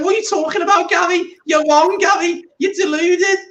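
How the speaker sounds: very high squeaky Scouse protest; Jamie Carragher, excited squeak. Acted. Excited pundit arguing with Gary, squeaky Scouse. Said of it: "starts getting more and more squeaky".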